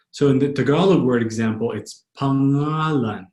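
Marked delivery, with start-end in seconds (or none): 2.2-3.3 slow "pangalan" as pa-NGA-lan, no hard g; "pangalan" slowly, middle vowel held; soft NG. Shown as a demonstration. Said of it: "Notice it's not a heavy G".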